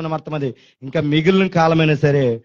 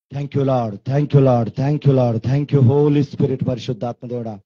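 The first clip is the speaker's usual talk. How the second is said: low, drawn-out thanks in prayer; 4 semitones lower, much slower than usual. Real, not acted. Preacher thanks the Lord, low and slow. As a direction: pray it slowly to the Lord.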